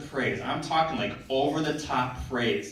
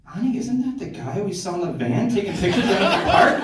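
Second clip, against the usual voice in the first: suspicious shopper; 8 semitones higher, 7 dB louder than usual. Retold or imitated. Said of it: "I heard somebody say".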